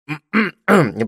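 loud ahem; throat clearing. Acted. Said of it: "сделал новую попытку".

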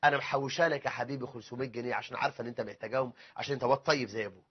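low, soft confiding voice; secretive indulgent mother. Acted. Mother behind father's back giving son saved money, hushed and coaxing. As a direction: slip it to the child on the quiet. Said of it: "تيجي من ورا ظهر الأب وتقول له".

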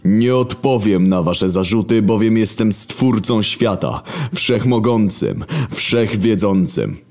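God drawling each word, very slow; slow menacing murmur. Acted. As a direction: Creator muses darkly over love. God proclaims himself.

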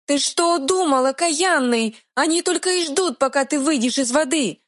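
panicked tiny fairy scolding; squeaky pixie. Acted. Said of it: "пропищала фея".

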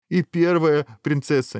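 high, drawn-out complaint; insistent protest. A narrator's reading. Hero pleading his case to the dragon, insistent. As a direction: keep pressing the complaint. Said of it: "не унимался герой".

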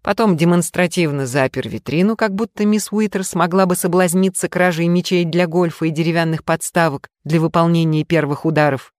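ordinary delivery

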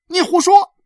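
put-on loud, high-pitched boy's shout; angry retort. A narrator's reading. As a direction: fling it back as an outraged child. Boy angrily contradicts her again.

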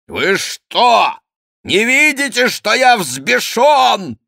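very high, loud furious roar; enraged roar. A narrator's reading. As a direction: bellow in rage, drawing out the end. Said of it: "взревел Монсоро". (яростный рёв)

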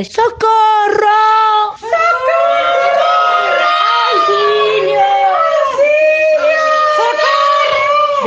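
very high, loud slow cries for help; princess crying for help. Acted. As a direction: shriek for help as the trapped princess. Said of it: "Y la princesa".

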